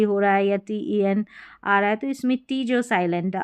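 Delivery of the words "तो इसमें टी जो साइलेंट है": ordinary talk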